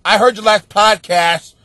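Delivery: imitating a coworker's loud, teasing scolding; mock loud scolding. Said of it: "She was like".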